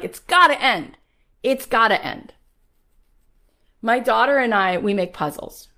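high, loud fired-up preaching; rally the crowd like a preacher; preaching at full force. Acted. Preaching to her audience.

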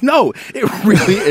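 high, loud protest breaking into a laugh; replayed indignant objection; pissed off. Dad arguing back to his daughter in the car, pissed off. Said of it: "I got so pissed off".

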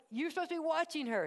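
half-playful dad reprimand; exasperated dad. Retold or imitated. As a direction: play the fed-up father catching the kid slipping. Dad scolding son at church.

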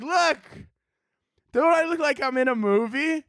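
high, showy voice of someone posing for the camera; mock vain poser. Mocking people posing in smoke.

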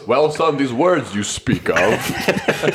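slow, drawn-out mock solemnity; mimicking co-player's dad. Friend mocking the improv dad's line, slow laughing imitation. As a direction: parody a grave father, amused. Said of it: "And Bill's".

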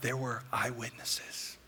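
slow, low near-whisper; hushed reveal. Real, not acted. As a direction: drop right down and let it sink in. Hushed reveal after guess what.

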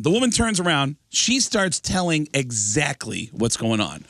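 ordinary talk

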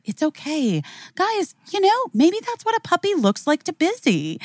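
high, indulgent teacher voice; retold soothing teacher. Teacher defends child's painting to classmates, high placating voice.

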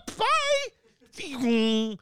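mock cheery escape. Mocking a fleeing road-rager.

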